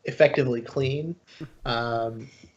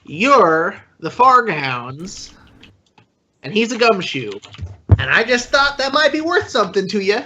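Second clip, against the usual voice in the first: higher, slow voice of a pathetic street bum; Krisa character voice; 8 semitones higher, 4 dB louder than usual. Acted. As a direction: drag it out, a shabby informant selling a tip. Krisa pitches info to guards.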